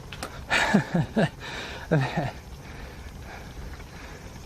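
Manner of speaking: heavy panting grunts; breathless grunting. Real, not acted. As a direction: grunt and pant from the climb. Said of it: "grunting that I'm doing here".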